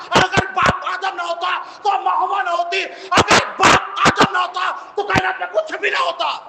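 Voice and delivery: rousing pulpit delivery; fiery preaching. Acted. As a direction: preach it to the whole hall. Preaching at full force.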